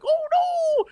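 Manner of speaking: squeaky high fake panic; mock alien panic. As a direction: squeal it like a cartoon victim. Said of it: "The aliens are like".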